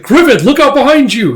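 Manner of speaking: high, loud alarm; shouted warning. Acted. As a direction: yell an acted warning to a friend. Warns of attack behind.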